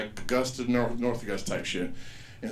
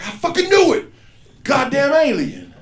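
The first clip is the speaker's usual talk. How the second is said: much higher, louder shout of vindication; triumphant yell; 13 semitones higher, 10 dB louder than usual. Retold or imitated. Comic shouting at the kid he knew was alien. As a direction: yell it like you caught him. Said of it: "I was like".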